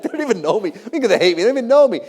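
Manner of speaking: high-pitched, rising disbelief; retold indignant protest. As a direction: protest the unfairness, climbing in pitch and volume. Retold protest at being hated.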